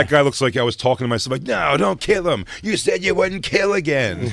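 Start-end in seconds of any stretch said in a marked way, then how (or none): 1.4-4.3 slow, high pleading inner voice; voice in his head. Retold or imitated. Man fighting in the hallway, slow high mock pleading. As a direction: beg slowly, way up high, like a madman's conscience.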